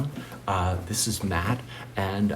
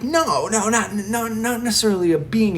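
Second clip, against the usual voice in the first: high, loud, tumbling denial; agitated objection; 10 semitones higher, 5 dB louder than usual. Retold or imitated. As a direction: rush to correct him, worked up. Retold heated debate with friend.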